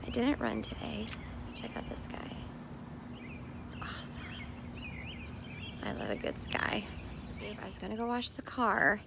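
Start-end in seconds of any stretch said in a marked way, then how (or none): 1.5-6.9 soft morning whisper to the camera. Real, not acted. Mom whispering at dawn to the camera, soft whisper. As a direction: breathe it, no voice. Quiet early morning filming.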